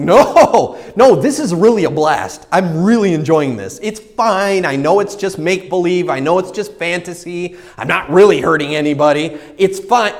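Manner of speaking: loud, high, insistent self-justifying; defensive protest. Retold or imitated. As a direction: argue back at your own conscience. Arguing back with his conscience.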